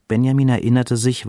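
usual speaking voice

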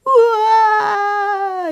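high loud wailing cry; a toddler's howling wail; baby wail. A narrator's reading. Little Tiki Taka bawling in the kitchen, loud wail. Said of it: "Tiki Taka weinte".

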